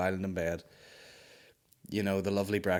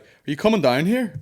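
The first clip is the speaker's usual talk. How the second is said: loud, high call from downstairs; 8 semitones higher, 10 dB louder than usual. Retold or imitated. Maureen calling up to her partner, loud high call. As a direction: shout it up the stairs to him.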